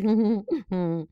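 high, loud happy laugh; bright delighted laugh. Acted. Tree laughs in wonder.

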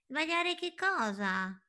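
very high, light bird voice; high little bird asking. Acted. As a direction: chirp it like a tiny curious bird.

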